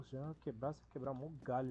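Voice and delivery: ordinary delivery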